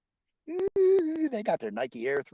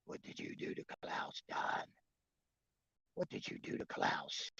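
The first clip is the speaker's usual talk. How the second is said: breathy whisper, much softer; 12 dB quieter than usual. Pretend. Asking Don about Klaus in a whisper. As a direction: hush it conspiratorially.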